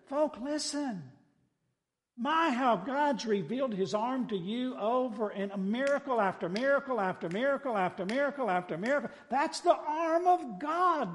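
high, driving pulpit exhortation; preacher piling up words, then stretching the climax; fervent preaching. Real, not acted. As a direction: plead with the congregation to see it.

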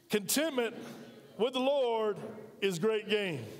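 spaced phrases, "Lord" lifted and pressed. Shown as a demonstration. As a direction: push the crowd to say it back. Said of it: "Try it with me. Come on".

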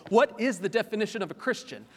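loud, urgent sermon voice; preaching at full force. Acted. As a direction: drive the question home to the congregation.

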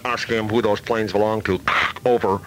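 crackly radio transmission with sign-off; car radio voice. Acted. Caller asks who owns the planes, car radio voice. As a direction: talk as if over a car radio. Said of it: "I talked like this for a while".

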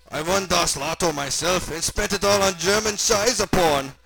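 high, loud, slow German character; gleeful German accent. Acted. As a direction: draw it out with German-accented glee. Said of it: "now bouncing with joy".

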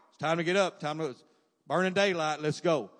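loud, brisk fatherly rousing; emphatic dad wake-up. Retold or imitated. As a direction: imitate Dad ordering the kids out of bed. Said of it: "even Dad was a little more".

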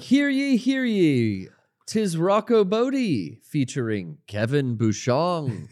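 town crier. Pretend. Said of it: "Now I'm doing it".

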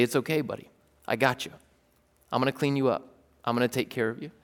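soft, tender reassurance; gentle comfort. Pretend. God comforting someone who feels too dirty, gently. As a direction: speak it as a loving father would.

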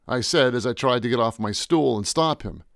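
ordinary delivery

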